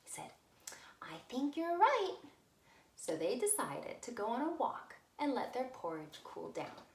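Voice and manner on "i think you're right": put-on Baby Bear voice.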